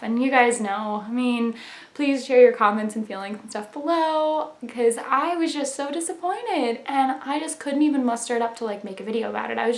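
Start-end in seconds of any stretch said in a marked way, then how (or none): none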